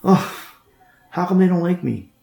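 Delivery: a touch of mock self-pity; mock puzzled complaint. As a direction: lightly imitate a rejected guy wondering why. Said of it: "you probably wonder, like".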